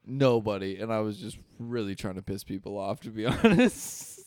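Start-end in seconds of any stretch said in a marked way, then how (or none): 3.0-4.2 laughing. Real, not acted. Laughing at own admission.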